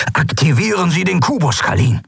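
high, insistent order; urging. A narrator's reading. Andalok urging Kalin to activate the cube, insistent. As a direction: push for action now. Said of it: "drängte Andalok".

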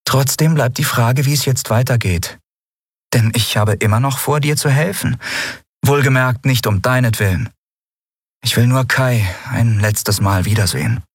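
Lien, amused and scoffing. Acted. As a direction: tease them for their fear, amused.

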